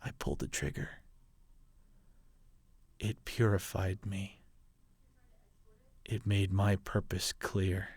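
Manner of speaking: quiet confession. Acted. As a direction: confess it softly, almost breaking off. Father confesses killing his own son.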